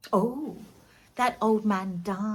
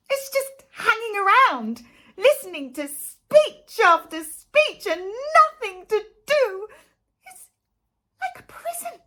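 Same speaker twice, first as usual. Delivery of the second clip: higher, louder frustrated moan; exasperated rant; 11 semitones higher, 7 dB louder than usual. Acted. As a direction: build the boredom into a wail. Frustrated rant about boredom.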